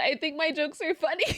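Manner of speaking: usual speaking voice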